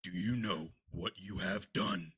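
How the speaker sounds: slow, quiet, low menace; slow quiet villain threat; low menacing question. Acted. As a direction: ask it slowly like a cold threat.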